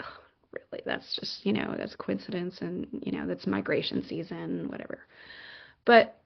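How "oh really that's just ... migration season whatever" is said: soft, muttered mock doubt; imitating unimpressed doubters, much quieter; dismissive skeptic. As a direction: shrug it off under your breath.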